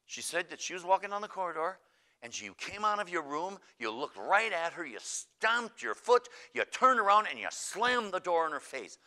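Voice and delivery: high, loud, building emphasis; hammered-out retold accusation; accusing recital. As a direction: lay out each charge forcefully.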